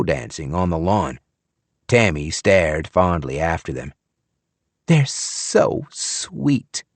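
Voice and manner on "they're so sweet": fond. A narrator's reading. Fond sigh at dancing couple.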